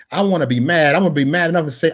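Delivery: ordinary delivery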